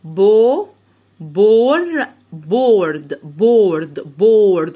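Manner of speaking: drawn-out, forceful "بورد" built up in steps; teacher sounding out "بورد" loudly; letter-by-letter blending. Shown as a demonstration. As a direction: loudly build بو, بور, then "بورد". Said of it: "بمشي حرف اتنين تلاتة واخد الكلمة على بعضها".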